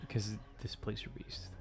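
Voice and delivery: hushed whisper, barely voiced. Real, not acted. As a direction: drop to a whisper for the answer. GM answers a rules question.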